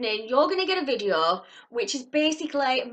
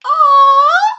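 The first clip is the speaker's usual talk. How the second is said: very high, very loud aww; delighted squeal; 14 semitones higher, 16 dB louder than usual. Real, not acted. Seeing the chocolate packaging, a very loud adoring aww. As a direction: let out a big adoring squeal. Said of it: "I get a good twenty-five percent squealia".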